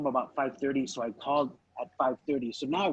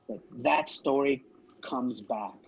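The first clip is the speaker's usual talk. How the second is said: ordinary talk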